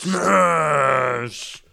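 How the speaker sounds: shouted announcer echo; monster truck ad. Acted. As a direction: bark the word like a promo. Echoing the ad shout.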